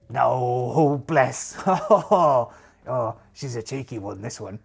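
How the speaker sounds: loud patronising pirate with a hearty laugh; amused pirate captain. Acted. Pirate laughing at the young witch's claim, amused role voice. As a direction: laugh it off like a disbelieving sea dog.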